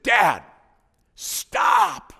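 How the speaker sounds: put-on high, loud child's stop; little daughter cutting her dad off, loud and high; confident six-year-old. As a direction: bark it like a bossy little girl. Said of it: "in her confident, six-year-old way".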